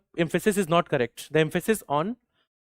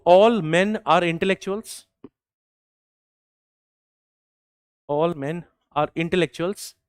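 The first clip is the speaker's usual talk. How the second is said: slow dictation; slower than usual. Shown as a demonstration. Dictating a quote.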